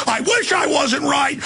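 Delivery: normal talking voice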